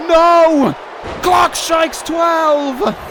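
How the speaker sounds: a loud, high cry of No; real despairing shout. Real, not acted. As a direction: yell as the match falls apart. The match is ruined.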